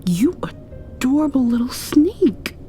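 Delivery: normal talking voice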